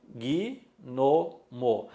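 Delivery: "gnomo" broken slowly into gui, no, mo; slow syllabified "gnomo" with an added i after g; three syllables. Shown as a demonstration. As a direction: sound out "gnomo" as three separate beats, gui-no-mo. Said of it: "Eu pronuncio em três sílabas".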